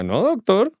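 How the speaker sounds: ordinary talk, nothing marked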